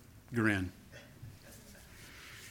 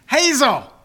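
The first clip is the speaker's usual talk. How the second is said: calling out; 9 semitones higher, 8 dB louder than usual. Real, not acted. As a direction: call her name across the room. Calling to his granddaughter.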